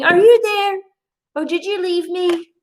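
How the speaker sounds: high, pleading call to her spirit team; pleading cry. Retold or imitated. Psychic reenacting her desperate plea to spirits, high and pleading. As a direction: plead like you've been abandoned. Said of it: "I kept saying".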